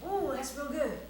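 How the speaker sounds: usual speaking voice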